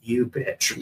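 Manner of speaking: soft retold inner curse; retold angry thought. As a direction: say it under your breath, as a thought. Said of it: "I was, like, thinking".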